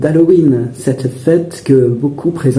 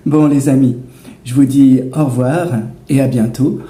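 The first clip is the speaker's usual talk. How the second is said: ordinary talk, nothing marked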